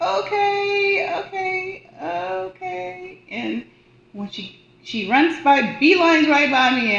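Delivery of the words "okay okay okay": soothing okays. Retold or imitated. Answering the child gently.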